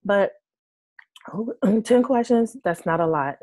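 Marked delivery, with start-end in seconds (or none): none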